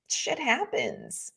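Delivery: put-on slow, soft Russian accent; a light Russian accent, laid-back. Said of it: "just in the sweet Russian accent".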